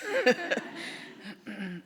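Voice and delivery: high, short real laugh. Real, not acted. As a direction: laugh lightly after thanking the audience. Laughs after thanking applauding audience.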